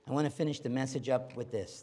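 ordinary delivery

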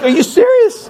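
very high-pitched disbelief; incredulous. Retold or imitated. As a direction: protest as if you can't believe it. Retold disbelief at man calling friend.

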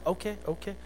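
gently placate, hands up; soft placating okay. Retold or imitated. Sales clerk soothing an angry customer, softly.

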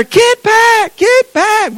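high, loud frantic shout; mama shouting. Retold or imitated. Mother shouting at her boy to get away. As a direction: yell the warning like a panicked mother.

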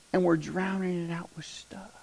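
sudden hushed whisper after the shout. Real, not acted. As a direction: drop to a whisper, draw them in.

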